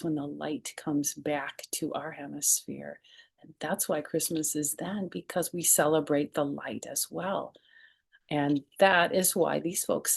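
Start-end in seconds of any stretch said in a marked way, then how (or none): none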